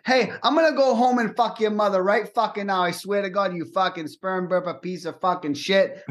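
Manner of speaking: high-pitched Boston rant; hammed-up Boston tirade; Boston accent. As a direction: rattle off threats in a Boston accent. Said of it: "in the Boston accent".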